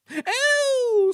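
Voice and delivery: piercing high scream; very high, loud squeal. A narrator's reading. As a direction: shriek in outrage. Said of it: "screamed Agnes".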